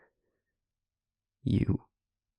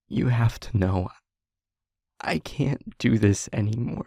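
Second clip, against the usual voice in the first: louder, raised voice, begging to be heard; desperate pleading; 3 semitones higher, 6 dB louder than usual. Acted. Begging the listener to let her explain, raised and desperate. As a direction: push through, she can't hold it in anymore.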